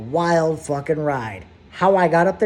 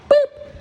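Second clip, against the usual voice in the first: sound effect; 5 dB louder than usual. Retold or imitated. Sound of putting car in park.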